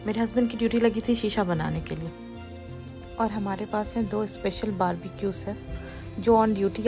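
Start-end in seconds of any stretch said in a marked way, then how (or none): none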